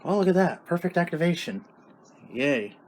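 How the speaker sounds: ordinary talk, nothing marked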